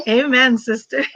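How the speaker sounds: ordinary talk, nothing marked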